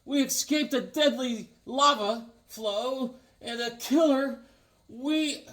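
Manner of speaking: high, slow comic hero read; hero narration. Acted. Reading the hero's caption, high and slow. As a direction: read it like an old serial hero. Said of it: "because he sees a dinosaur".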